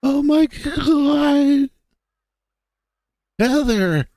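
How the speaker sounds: suddenly frantic, pitch shooting up; rising urgency. Acted. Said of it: "increasing sense of urgency takes hold".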